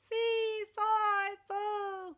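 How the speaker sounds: high loud cut-off giant chant; fading as he tumbles; falling giant. A narrator's reading.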